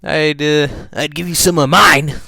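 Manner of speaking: put-on high, loud kid-character voice; high-pitched, loud eager offer; Dill's character voice. A narrator's reading. Voices Dill offering his pants.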